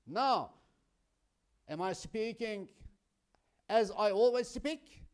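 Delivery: loud, tense questioning; nervous angry voice. Shown as a demonstration. Teacher shows students an angry tone: loud. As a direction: sound angry on purpose. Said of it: "No, kindly, no, I'm not a kind person now".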